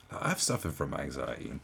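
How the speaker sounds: ordinary delivery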